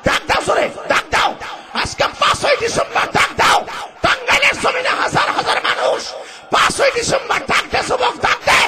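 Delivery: very high, straining shouted cry; hoarse preaching shout. Acted. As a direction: scream the call to the crowd. (চিৎকার করে ওয়াজ)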